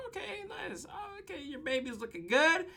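high, very soft little voice; hushed high-pitched approving murmur; polite approval. Acted. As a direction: coo it quietly, inspecting politely. Acting teacher politely admiring babies.

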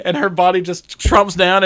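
normal talking voice

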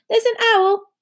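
high-pitched, insistent call; Harry, loud. A narrator's reading. Harry warning sleeping Hagrid about the owl, loudly. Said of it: "said Harry loudly".

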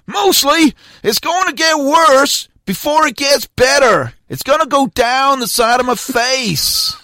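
put-on high, slow American accent; drawn-out put-on American accent. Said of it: "that American accent".

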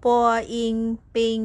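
high, loud chant sounding out bing; spelling out bing. Shown as a demonstration. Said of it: "พันแบบนี้นะคะ".

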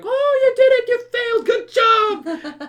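very high, loud overjoyed cheering; cheering family. Retold or imitated. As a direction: gush over him like a proud family.

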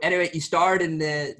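ordinary delivery

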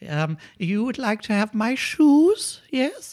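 put-on high, sweet old lady voice. Old lady happily answering the burglar, high sweet voice. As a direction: play a doting old lady.

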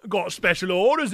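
put-on Cockney accent. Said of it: "I nailed his voice".

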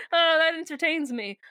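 usual speaking voice

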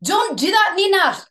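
high, emphatic mock Scottish accent; playful Scottish telling-off, pitched high. Shown as a demonstration. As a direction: scold in a put-on Scottish accent. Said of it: "I can do Scottish".

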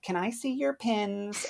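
put-on high, drawn-out child's request; kid asking. Kid asking the cast member to see pins, sing-song child voice. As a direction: lilt it high and slow like a child. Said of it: "they'd be like".

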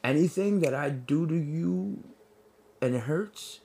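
stern father. Acted. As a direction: lay down the law softly and deliberately. Voices a stern father laying down rules.

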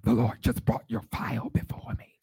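mimicked gasp, then whispery pronouncement; gasp, then imitate a hushed revelation; Margaret Washington. Colleague interrupting small talk with a revelation, mimicked whispery voice. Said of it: "She'd be like".

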